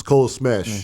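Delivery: Color Smash with a thick Long Island accent, kolə. Shown as a demonstration. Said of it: "my Long Island accent goes".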